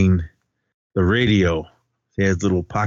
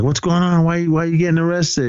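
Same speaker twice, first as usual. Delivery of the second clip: high-pitched, puzzled questioning; retold bewilderment; 8 semitones higher than usual. Students asking their principal about his arrest, bewildered. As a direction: imitate baffled onlookers, voice raised high.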